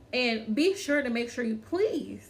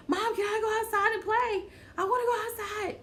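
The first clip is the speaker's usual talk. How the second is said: put-on lightly pitched up, whining kid begging to play; whiny child; 9 semitones higher, faster than usual. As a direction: plead like a restless child. Child voiced asking mom.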